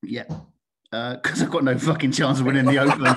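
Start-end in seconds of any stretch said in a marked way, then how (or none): 1.2-3.2 loud, self-mocking, breaking into laughter; real laugh bursting through the words. Real, not acted. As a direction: crack up at your own joke. Joking at own expense, laughing.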